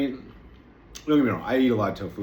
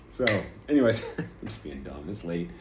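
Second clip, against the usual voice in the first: usual speaking voice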